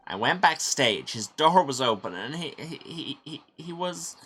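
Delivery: high, rattled account breaking into stammers; shocked stammering. Acted. Witness telling how they found the body, shocked stammering. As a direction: start loud, then falter and stammer.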